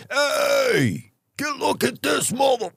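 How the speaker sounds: high rowdy cheer; mock cheering firefighter. Firefighters cheering the promoted colleague, high and rowdy. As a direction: hype up the buddy, lightly voiced.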